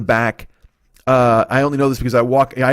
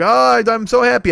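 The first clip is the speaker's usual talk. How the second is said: put-on high, gushing old-man delight; 10 semitones higher, 4 dB louder than usual. Deli owner thanked for his sign, gushing and high. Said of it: "And he's like".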